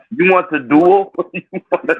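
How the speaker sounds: high-pitched mocking quote; mock challenge. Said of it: "They got".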